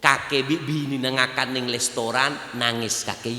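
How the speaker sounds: usual speaking voice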